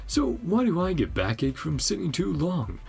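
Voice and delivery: animated, swooping complaint; a touch of hearty showing off; lively game character proud of fishing; lightly voiced boastful angler.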